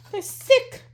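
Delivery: high, loud burst of hype; excited. Real, not acted. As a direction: shout it out, thrilled. Reacts to the postcard.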